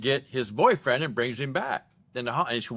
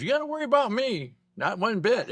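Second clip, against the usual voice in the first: high, squeaky mocking reply; squeaky teasing retort; 11 semitones higher than usual. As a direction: pitch it way up like a teasing friend.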